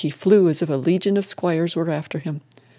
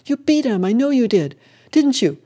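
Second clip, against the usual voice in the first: excited; 6 semitones higher than usual. A narrator's reading. Widow exults over the win.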